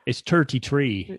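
mock-Irish accent, leaning on the number. Shown as a demonstration. Showing a co-host how Irish people say the number, mock Irish accent. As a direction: put on a leprechaun-style Irish accent. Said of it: "great one to hear Irish people".

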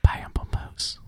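whisper. Shown as a demonstration. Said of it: "whispered repeat of the word".

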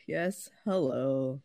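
low slow mopey hello; dejected imitation. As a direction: drag it out like you're defeated. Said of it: "sound so dejected about saying hello".

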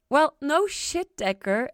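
affectionately. A narrator's reading. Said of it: "Ella said with affection".

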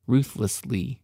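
"ruthlessly" said slowly, each syllable careful; retrying "ruthlessly" with care. Shown as a demonstration. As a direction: sound out "ruthlessly" deliberately. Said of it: "try one more time".

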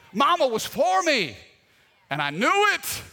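loud, stressed FOR; emphatic point. Real, not acted. Preacher telling the congregation his mom backed him, emphatic. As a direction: lean hard on for.

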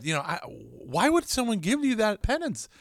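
high-pitched puzzled protest; acted bewilderment. Asking a priest about the penance, high-pitched bewilderment. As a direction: imitate a baffled, high-voiced complaint.

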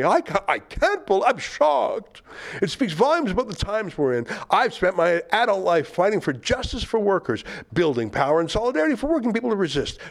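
high-pitched, hammed-up outrage; mock indignation. Host reads an activist's tweets aloud, mock-indignant.

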